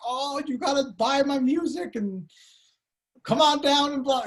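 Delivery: high, loud hammed-up salesman hype; mock the screaming car salesman; over-the-top commercial huckster voice; pushy salesman pitch. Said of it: "You don't wanna be like".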